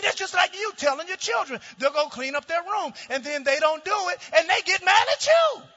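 loud, high, driving sermon voice; preaching at full force. Acted. Pastor preaching to his congregation, full-force shouting. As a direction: preach it hard, rising to a shout.

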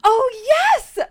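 high, loud excited gasp and cry; excited outburst. Real, not acted. Co-host hears friend's show news, excited outburst. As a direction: burst out loudly with delight.

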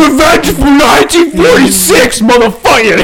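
very high, full-volume frenzied shout; hammed-up battle yell ending near laughter; mock vengeful roar. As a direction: play a crazed soldier screaming about payback. Acts vengeful soldier yelling.

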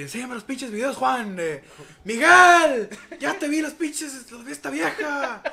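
high, shrill-free mock jealous rant; jealous girlfriend. Girlfriend accusing boyfriend over liked photos, mock jealous rant. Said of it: "se pelean la típica parejita".

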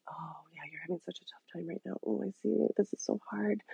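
soft, hushed soothing imitation; Janet Lansbury's voice. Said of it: "I get Janet Lansbury's voice in there going".

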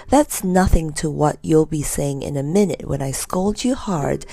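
ordinary delivery

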